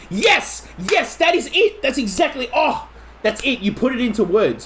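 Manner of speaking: high delighted yes, yes; thrilled someone nailed it; excited agreement. Real, not acted. Cheering the listener who put his feeling into words, excited and high.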